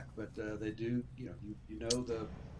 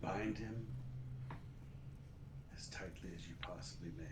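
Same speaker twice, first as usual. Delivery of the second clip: Skinnis advising gravely; 5 semitones lower, 12 dB quieter than usual. Acted. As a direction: give grave advice very softly, with long pauses. Game master voices advisor Skinnis.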